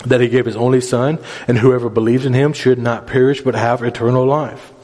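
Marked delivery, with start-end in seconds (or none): none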